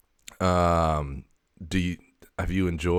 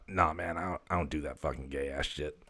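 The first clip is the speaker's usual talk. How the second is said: a softer, lightly voiced cat; the cat's sly dig, said softly; voicing the cat Graphite; 8 dB quieter than usual. The cat teasing its owner, a softer sly voice.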